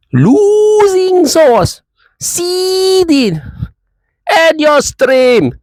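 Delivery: very high, very slow mocking voice; mocking impersonation. Vlogger impersonating a rival YouTuber, drawn-out and jeering. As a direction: stretch it out high like a jeering imitation. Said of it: "impersonation of you".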